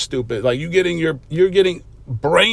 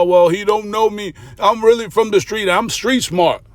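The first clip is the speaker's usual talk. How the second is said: higher, sneering mock of a braggart; mimicking the guy bragging he's street smart, mocking and higher; mocking imitation; 6 semitones higher than usual. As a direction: parrot his bragging back with scorn.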